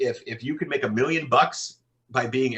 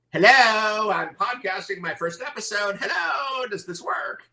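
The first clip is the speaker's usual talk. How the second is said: much higher, hammed-up clueless beginner; mock newbie podcaster; 12 semitones higher, slower than usual. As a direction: parody a nervous first-timer testing the mic. Mocking a clueless first-time podcaster.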